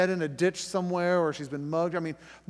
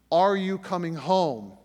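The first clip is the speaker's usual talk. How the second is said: stern question; 4 semitones higher, 9 dB louder than usual. Retold or imitated. Tense phone call to wife.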